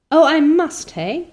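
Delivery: high, loud sarcastic retort; mock her with an indignant jab. Miss Barry retorting to Anne, high loud sarcasm.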